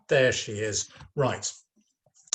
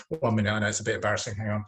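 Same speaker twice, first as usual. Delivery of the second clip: ordinary talk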